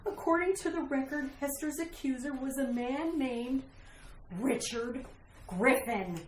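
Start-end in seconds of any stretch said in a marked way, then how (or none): 4.4-6.2 hushed, much lower, name by name; ominous revelation. Acted. Wife reveals the accuser's name, ominous. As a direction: drop low and soft on the fateful name.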